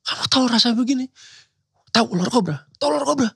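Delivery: high, fast, hammed-up melodrama; theatrical soap-opera delivery. Actor mocking a soap-opera script, high and theatrical. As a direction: overplay it like a soap villain. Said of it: "Dalam hati gue itu kan teatrikal ya".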